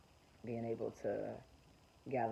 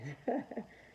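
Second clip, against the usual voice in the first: short high laugh of scorn; a quick mocking laugh; high scoffing laugh; 12 semitones higher than usual. Real, not acted. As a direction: laugh it off in disbelief. Laughs at rapture belief.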